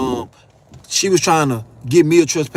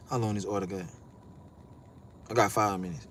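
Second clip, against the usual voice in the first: much lower, softer; low muttered aside; 11 semitones lower, 15 dB quieter than usual. Real, not acted. Checking on the order's wait, a low quiet aside. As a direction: murmur it as an aside.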